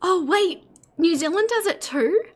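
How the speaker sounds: high, lifted voice of sudden discovery; wide-eyed amazement, pitch jumping up; pleasantly surprised. Real, not acted. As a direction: react with real surprise at news to you. Learns a new fact from chat.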